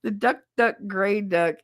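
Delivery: normal talking voice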